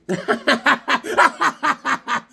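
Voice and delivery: long high cackling laugh; laughing at own joke. Real, not acted. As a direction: crack up at your own punchline.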